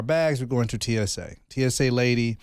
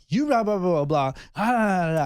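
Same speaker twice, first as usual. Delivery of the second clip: high gibberish imitating an argument; hammed-up blah-blah squabble; mock argument; 5 semitones higher than usual. Mocking wife arguing at TSA.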